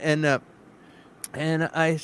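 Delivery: ordinary talk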